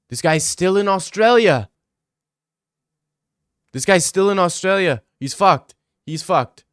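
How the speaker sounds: loud, high, incredulous repeats; astonished outburst. Real, not acted. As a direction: exclaim it like shocking news. Exclaims over surfer still in Australia.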